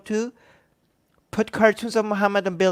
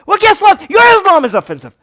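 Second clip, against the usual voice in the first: furious high shout, rushed at the end; real angry shout; yelling; 13 semitones higher, 12 dB louder than usual. Real, not acted. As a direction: explode at them at full volume. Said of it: "I need to yell at you guys".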